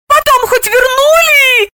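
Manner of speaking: loud, high, drawn-out wail; pained high-pitched cry; anguished moan. A narrator's reading. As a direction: moan as if suffering. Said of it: "страдальчески простонала она".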